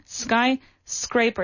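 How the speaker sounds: Skyscraper, slow, scraper rhyming with paper; drawn-out model Skyscraper; correct pronunciation. Shown as a demonstration. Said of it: "That's wrong".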